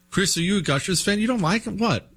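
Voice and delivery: high, fast disbelief; can't believe what he's hearing; incredulous. Real, not acted. Incredulous at cohost.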